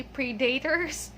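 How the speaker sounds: "predators" as pree-DAY-ters, imitating teachers; teachers' mispronunciation. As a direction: say "predators" with a long DAY in the middle. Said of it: "They say predators".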